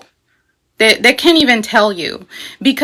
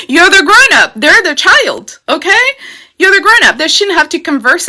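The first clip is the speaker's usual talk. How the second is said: higher and louder, driving the point home right after a laugh; emphatic insistence; 7 semitones higher, 4 dB louder than usual. Real, not acted. Astrologer telling viewers that adults must know, higher and louder emphasis. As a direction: pitch it up and push hard.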